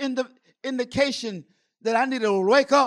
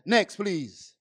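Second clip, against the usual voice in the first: usual speaking voice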